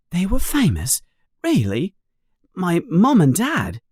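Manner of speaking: put-on high boy's voice, astonished; amazed boy. A narrator's reading. Harry learns of famous parents, amazed.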